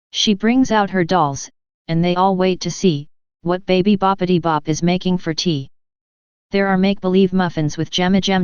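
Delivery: gentle sing-song rhyme reading; reading a rhyme to children. Acted. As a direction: read the playful rhyme to a child.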